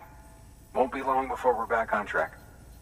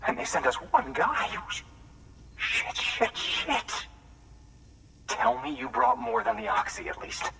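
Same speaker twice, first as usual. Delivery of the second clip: high-pitched, exasperated disbelief; exasperated outburst; 9 semitones higher than usual. Acted. Waiting worker learns only one guy was sent, exasperated. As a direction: vent years of frustrated waiting, pitched well up.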